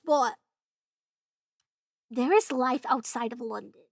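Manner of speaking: normal talking voice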